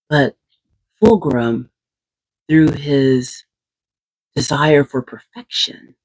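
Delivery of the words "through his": usual speaking voice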